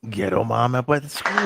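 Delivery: ordinary talk, nothing marked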